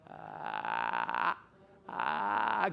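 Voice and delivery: creaky fry "ah" like a door hinge; vocal fry groan; squeaky-hinge vocal fry. Shown as a demonstration. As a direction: let the sound creak like an old door. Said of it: "I call it a squeaky hinge".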